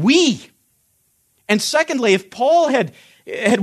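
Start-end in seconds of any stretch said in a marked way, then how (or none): 0.0-0.5 "We." punched higher and louder on its own; emphatic we. Shown as a demonstration. Teacher driving home the emphatic pronoun to his class, punched high and loud. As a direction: hit "We." hard, like a pointing finger. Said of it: "the pronoun is emphatic".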